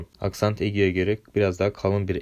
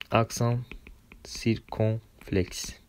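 slow careful naming; 2 semitones higher, 5 dB quieter, much slower than usual. Shown as a demonstration.

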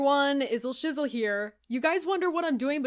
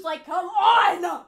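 loud, drawn-out plea; exasperated shout; 7 semitones higher, 7 dB louder, much slower than usual. Pretend. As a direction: groan it out loud to the viewers.

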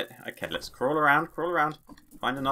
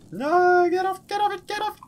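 very high, panicky squeal; panicked squeal; 3 dB louder than usual. Real, not acted. Player attacked by a spider, high panicked squeal. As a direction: squeal it high, frantic to shake it off.

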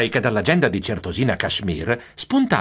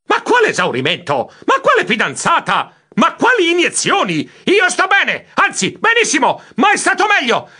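exasperated yelling into the phone, climbing higher; full-lung shouting; 4 dB louder than usual. A narrator's reading. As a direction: build from indignant protest to a long high scream. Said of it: "gridai a pieni polmoni nel telefono". (urlo esasperato a pieni polmoni)

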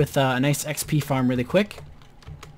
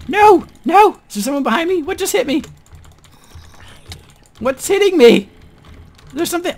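high frantic yelling; panicked shouting; 8 dB louder than usual. Real, not acted. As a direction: shriek while being attacked. Under attack in game.

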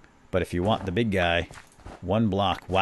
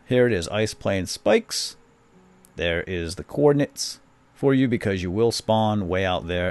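ordinary talk, nothing marked

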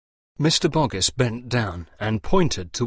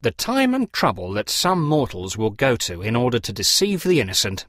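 plain reading voice

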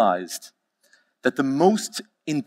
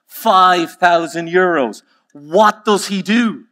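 high, loud, drawn-out disbelief; flabbergasted, slow and ringing; astonished customer; 7 semitones higher, 5 dB louder, slower than usual. Acted. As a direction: cry out the price in outrage at the cost. Joke customer astonished at top price.

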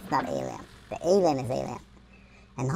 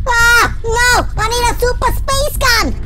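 mock panicked yelling; 11 semitones higher than usual. Player caught with only a cheese gun, high panicked yelling. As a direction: yell high as if cornered.

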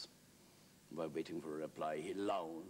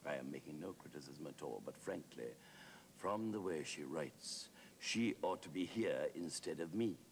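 solemn deadpan Wilde; very grave; 2 semitones lower, 3 dB quieter than usual. Acted. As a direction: weigh each phrase gravely, halting between them. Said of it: "Wilde said very gravely".

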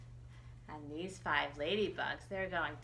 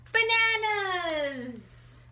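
drawn-out excited; 13 semitones higher, 9 dB louder, much slower than usual. Acted. Reader reveals page answer.

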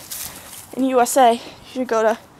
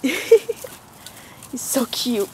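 soft cooing; 5 dB quieter, much slower than usual. Real, not acted.

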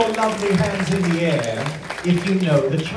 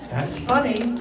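mock delight; 7 semitones higher than usual. Repeating own joke two ways.

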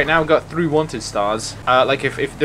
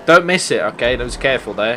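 ordinary talk, nothing marked

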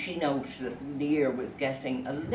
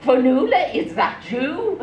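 astonished recognition; 8 semitones higher, 6 dB louder than usual. Acted. Father finds his daughter is a swan, astonished cry. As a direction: call the name in hammed-up amazement.

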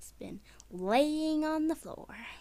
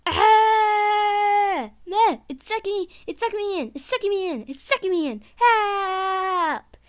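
loud, high shrieks for help; panicked screaming; 8 semitones higher, 8 dB louder than usual. Acted. Kid being sucked in and calling for help, panicked. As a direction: scream in panic as you're pulled away.

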